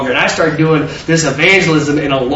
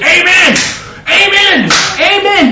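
high, loud repeated cry; exultant amen; 13 semitones higher, 3 dB louder, slower than usual. Retold or imitated. The witness inside answers a testimony, exultant cry. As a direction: belt out each amen, pitched way up.

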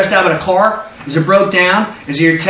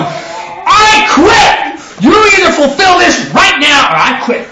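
high, shouted threat to quit; angry ultimatum; 9 semitones higher, much slower than usual. Retold or imitated. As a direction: yell it like a fed-up worker. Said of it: "are you talking to him going".

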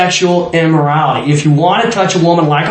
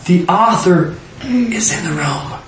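slow stage whisper; mock-secretive, drawn-out whisper; hushed warning; 4 dB quieter, slower than usual. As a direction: whisper it as if he might overhear. Hushed warning about author present.

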